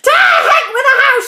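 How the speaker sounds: high, loud and fast; fast shouting crone. A narrator's reading. Grandma shouts at George, fast and loud. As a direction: bark it out like a reckless old grandma. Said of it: "she shouted".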